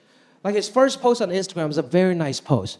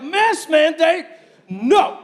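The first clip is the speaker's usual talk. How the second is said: high, loud, slow indignant refusal; mock outraged shout; 7 semitones higher, 5 dB louder, much slower than usual. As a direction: bellow it like a defiant rebel. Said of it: "They're like".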